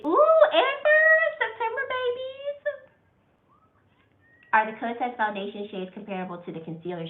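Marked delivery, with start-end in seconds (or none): 0.0-2.9 high delighted squeal over a shared birthday; excited squeal. Real, not acted. Greeting a viewer who shares her birthday, thrilled and high.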